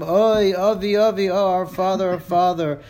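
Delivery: put-on mourning children crying out, lightly performed; children wailing. Teacher voicing the mourning children at the funeral, mild acted wail.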